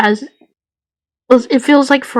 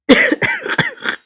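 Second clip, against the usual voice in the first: hammed-up cough. Ghost coughs mid prophecy.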